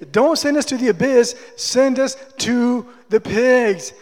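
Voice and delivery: loud, pleading demon voice, the second half slowed; demon begging. A narrator's reading. Demon begs Jesus to send them into the pigs, loud and pleading. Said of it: "the demons were begging".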